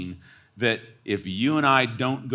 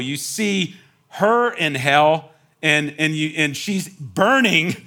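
usual speaking voice

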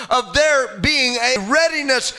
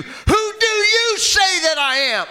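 higher, slower, ringing challenge; shouted question; 7 semitones higher than usual. Acted. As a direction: throw the question out to everyone. Repeated question now shouted.

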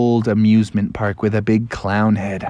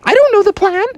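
squeaky Robin voice; 4 dB louder, faster than usual. Acted. Robin confused, very high.

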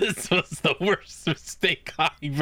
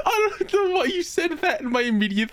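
very high, laughing almost to tears; helpless laughter. Real, not acted. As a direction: squeeze the words out through laughter. Cracking up at crude Pikachu joke.

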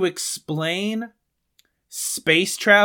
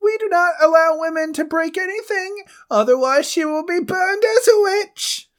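very high, put-on old-world voice; medieval peasant voice; 11 semitones higher, 3 dB louder than usual. Man from the past questions a stranger, very high put-on voice. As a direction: squeak it like a frightened peasant from the past. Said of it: "I'm the one that has to do the accent".

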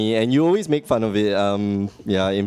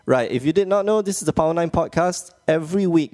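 higher, brighter show intro read; announcer intro; 6 semitones higher, faster than usual. Acted. Host introducing the podcast to listeners in announcer voice.

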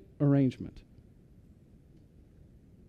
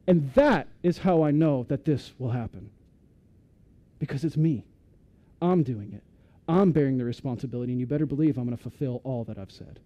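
ordinary talk

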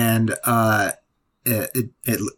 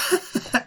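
chipmunk giggle; 4 dB quieter than usual. Pretend. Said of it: "crappy chipmunk voice".

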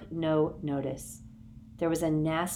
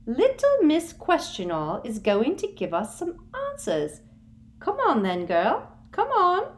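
high, taunting coax; a sugary bully; Umbridge coaxing; 10 semitones higher, 5 dB louder than usual. A narrator's reading. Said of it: "Umbridge coaxing".

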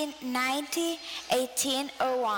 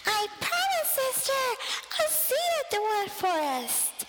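crying out; 10 semitones higher than usual. Acted. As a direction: call out to the sister, pleading. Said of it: "I cried".

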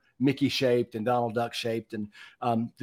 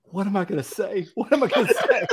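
panicked self-quote; 7 semitones higher than usual. Retold or imitated. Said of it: "I was like".

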